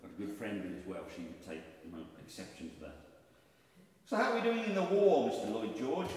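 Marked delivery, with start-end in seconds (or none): 4.1-6.1 loud, high-pitched royal enquiry; a comic king addressing his prime minister; George the Fifth. Acted. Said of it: "conversation between George the Fifth".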